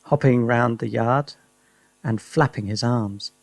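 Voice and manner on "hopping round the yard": usual speaking voice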